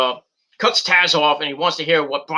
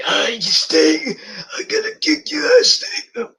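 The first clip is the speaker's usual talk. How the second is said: imitating Jake Roberts; 7 semitones higher, slower than usual.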